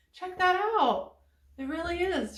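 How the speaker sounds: excited. Real, not acted. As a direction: show it off gleefully. Showing stretchy candy.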